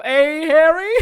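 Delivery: loud, high teasing jab; mocking jab. Kay teasing Harry about the angel, loud mocking jab. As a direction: needle him with a grin.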